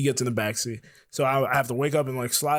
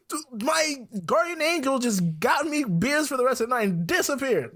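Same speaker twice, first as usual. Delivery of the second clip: high-pitched, mock-awed excitement; amazed delight; 11 semitones higher than usual. Drinker amazed his guardian angel bought beers, delighted. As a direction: marvel at a stroke of luck, pitched way up.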